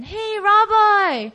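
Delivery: high, drawn-out, hearty hail; friendly greeting. Acted. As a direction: greet him like an old buddy. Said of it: "So as a friend".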